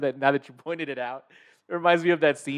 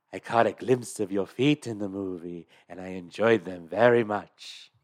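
Vincent Price; 6 semitones lower, 3 dB quieter than usual. Acted. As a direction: savour each word with creepy politeness. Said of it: "a good Vincent Price impression".

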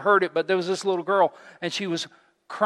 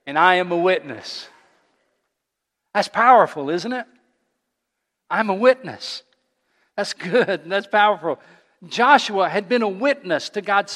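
louder, higher preaching voice; preaching at full force; 4 semitones higher, 6 dB louder than usual. Acted. Pastor stirring the congregation, preaching loud. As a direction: drive the point home to the church.

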